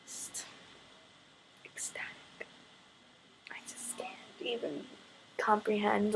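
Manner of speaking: whispered, overcome with joy; breathless whisper. Real, not acted. Fan telling viewers how happy she is, in an awestruck whisper.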